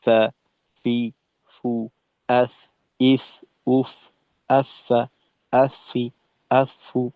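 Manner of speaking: Arabic letter fa drilled with each vowel. Shown as a demonstration. Tajweed teacher drilling the letter ফা for students. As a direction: sound out ফা ফি ফু আস ইস উফ আফা আফি আফু one by one. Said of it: "ফায়ের ক্ষেত্রে দেখলাম".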